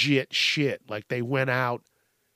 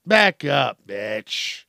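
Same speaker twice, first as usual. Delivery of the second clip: demonstrated firm warning; 5 dB louder, much slower than usual. Shown as a demonstration. Showing how to tell someone off, firm loud warning. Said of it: "That's how you do that".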